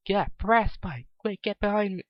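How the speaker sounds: urgent warning. A narrator's reading. Rainbow Dash warning Cream of the creature, urgent alarm. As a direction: shout a warning to protect her.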